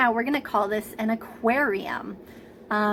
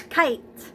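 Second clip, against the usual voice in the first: "Kite" as one raised, isolated beat; clapped-out syllable; 4 semitones higher than usual. Shown as a demonstration. Teacher clapping with preschoolers, one beat for the word. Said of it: "ready to clap?".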